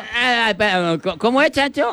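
high, halting put-on voice; cleft-lip character attempt. Joke teller searching for the boy, high halting voice. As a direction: feel for the voice, high and stammering.

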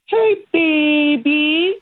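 put-on high, slow cooing baby talk. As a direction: coo it like to a pet. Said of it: "Like pet talk voice?".

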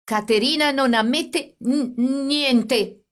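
stammering. A narrator's reading. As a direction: trip over the words, shaking with fear and anger. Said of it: "egli disse balbettando".

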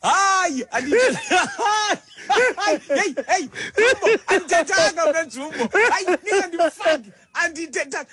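high, laughing denial; laughing protest. Real, not acted. To his co-host, denying he said it about Jumbo, laughing high protest. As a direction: protest through laughter that you said nothing.

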